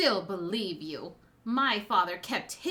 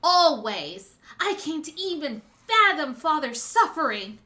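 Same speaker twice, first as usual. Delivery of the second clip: impassioned speech, building louder toward the end; passionate; 5 dB louder than usual. Acted. Daughter recounting father's suffering to his betrayer, passionate. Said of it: "You were very passionate there".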